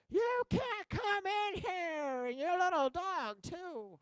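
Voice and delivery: high, drawn-out wicked witch parody; mock villainess screech, slow and high; mean store lady. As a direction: stretch every word, high and mocking. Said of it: "this lady come out and said".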